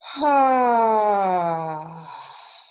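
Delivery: long, loud sigh falling in pitch; audible exhalation. Shown as a demonstration. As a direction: demonstrate a big open "haaa" release. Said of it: "audible sound".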